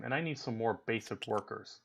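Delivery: ordinary talk, nothing marked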